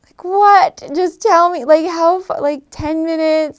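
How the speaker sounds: loud, high-pitched exasperated demand. Retold or imitated. Newcomer wanting a real distance from Australians, exasperated. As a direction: press for a straight answer in mock exasperation. Mock exasperation at vague answers.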